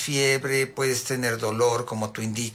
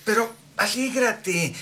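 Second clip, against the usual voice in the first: higher, drawn-out coaxing; mock pep talk; 6 semitones higher, slower than usual. Well-meaning visitor urging a sad patient on, mock-encouraging. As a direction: imitate someone cheering up a patient, singsong. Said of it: "Y luego les dicen".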